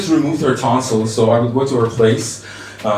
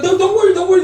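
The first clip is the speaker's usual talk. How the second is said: high-pitched, hurried mock comforting; flustered reassurance; faster than usual. Teacher consoles a crying student, flustered reassurance. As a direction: hush a crying pupil in a raised voice.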